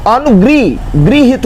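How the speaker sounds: अनुग्रही, गृहित with 'gri', in pieces; correct pronunciation. Shown as a demonstration. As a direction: break off after a-nu-gri, then say गृहित as gri-hit. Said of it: "ये शुद्ध है". (अनुगृहीत में 'गृ' पर ज़ोर)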